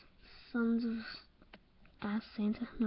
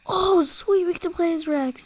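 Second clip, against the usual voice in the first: much higher, louder delighted cry; excited fan outburst; thrilled; 7 semitones higher, 8 dB louder than usual. Real, not acted. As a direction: gush like a fan who got his wish. Thrilled to play as the dog.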